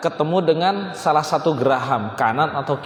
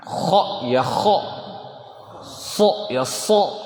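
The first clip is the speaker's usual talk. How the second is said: "Kho" and "so" slow, pressed hard and rounded; bunyi ditekan; much slower than usual. Shown as a demonstration. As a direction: lean on each "kho" and "so", drawn out. ("kho" dan "so" ditekan pelan)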